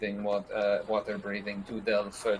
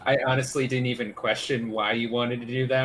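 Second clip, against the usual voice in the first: ordinary talk, nothing marked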